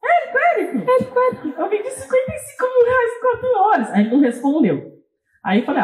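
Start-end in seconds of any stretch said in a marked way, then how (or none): 0.0-3.9 high little squeaky voice, laughing; cute high voice with laughter. Retold or imitated. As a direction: squeal it like an excited kid. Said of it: "Com a vozinha eu escrevi".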